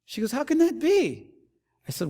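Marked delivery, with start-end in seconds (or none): 0.3-1.3 mock astonishment. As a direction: gasp it out in disbelief.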